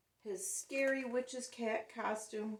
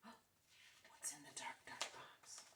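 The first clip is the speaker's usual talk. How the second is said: slow, breathless spooky whisper; 5 semitones lower, 15 dB quieter, slower than usual. Acted. Teacher asking the class in a ghost whisper. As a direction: pull them in close to the secret.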